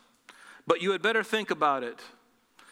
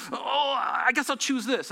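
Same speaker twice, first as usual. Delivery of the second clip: higher, slow, drawn-out groan of giving in; hammed-up helpless surrender; mock agonized indecision; 7 semitones higher than usual. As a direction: give in reluctantly, hamming up the agony. Acted reluctant giving in to choice.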